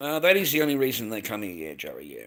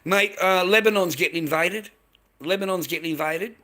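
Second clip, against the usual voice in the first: loud, raised-pitch challenge; heated challenge; 4 semitones higher, 6 dB louder than usual. Real, not acted. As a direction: call the heckler out at raised volume.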